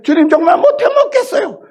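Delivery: high, loud desperate cry to God; half-laughing mock outcry. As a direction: throw the complaint up to heaven. Crying out to the Lord.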